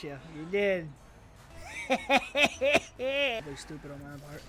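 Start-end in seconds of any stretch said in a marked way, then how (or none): 1.5-2.9 high laugh. Real, not acted. Gamer at own stupid play, laughing. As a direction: cackle up high in quick bursts.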